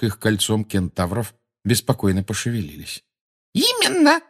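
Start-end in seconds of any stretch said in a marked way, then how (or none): 3.5-4.2 very high-pitched squeal; high shriek. A narrator's reading. Umbridge confirms to the centaurs, high shriek. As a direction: squeal it at the top of your range. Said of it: "провизжала Амбридж на ещё более высокой ноте".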